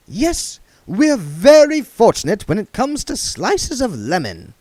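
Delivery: high, fussy genteel voice; prissy old vagrant, fussing; duck man voice. A narrator's reading.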